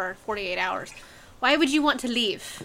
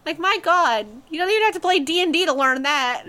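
loud, higher-pitched exasperation; exasperated; 5 semitones higher, 6 dB louder than usual. Real, not acted. Viewer ranting at film characters' bad tactics, loud and exasperated. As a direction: raise your voice in disbelief at their stupidity.

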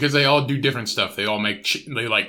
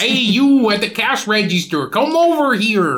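higher, louder, slow drawn call; mock Canadian accent; 7 semitones higher, 3 dB louder, slower than usual. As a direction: summon the cashier in a hammed Canadian accent. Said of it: "That's Canadian".

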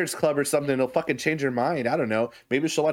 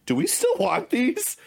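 imitating wife; 10 semitones higher than usual. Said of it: "she's literally said the other day".

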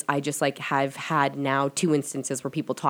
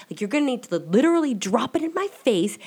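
high, raised, fed-up demand; exasperated demand; 8 semitones higher, 4 dB louder than usual. Retold or imitated. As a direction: push it hard at the spirits. Demanding signs from the spirits.

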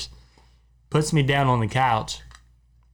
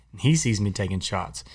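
ordinary talk, nothing marked

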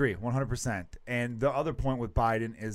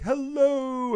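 high, drawn-out mock greeting; put-on singsong hello, pitched way up; Seinfeld-style goofy hello; 11 semitones higher, 5 dB louder than usual. Co-host mimicking a Seinfeld bit, goofy hello. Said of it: "like that whole Seinfeld episode where it's like".

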